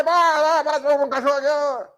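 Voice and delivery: high, loud exasperated father voice; imitating a father scolding his frightened son; mock impatient father. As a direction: snap at the child as the pushy dad. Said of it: "E o pai".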